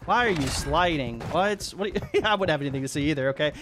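ordinary talk

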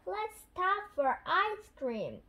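lightly voiced granny. A narrator's reading. Granny Pig suggests a treat, lightly voiced granny. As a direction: lift the pitch a little for the granny's suggestion.

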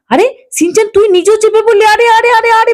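high, rising panic as the bike tips; losing balance and crying out in alarm; panicked alarm. Acted. Uncle losing control of the bike, panicked cries.

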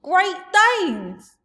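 high, loud giant crow; exultant giant yell; triumphant cry. Acted. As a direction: shout it in triumph. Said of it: "the giant cried triumphantly".

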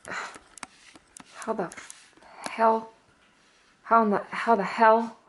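exasperated how the hell; fed up, loud burst; frustrated. Real, not acted. Frustrated at camera setup.

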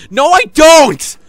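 very loud, high protest; indignant shout. Pretend. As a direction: yell back in exasperation. Shouting back at the game.

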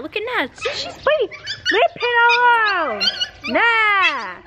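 high, sharp scolding; scolding the dog. Real, not acted. Owner scolding the biting dog, sharp and high.

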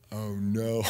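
low, sinking reaction; acted dismay. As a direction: react with mock dread. Retells dismay at boy's answer.

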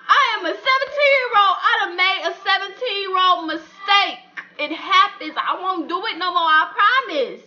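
put-on teen begging his parents for mercy; desperate pleading. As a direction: beg them not to.

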